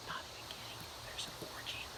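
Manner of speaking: very soft whisper; whispered aside. Real, not acted. Storyteller swears to listeners it's true, whispering. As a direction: confide it under your breath.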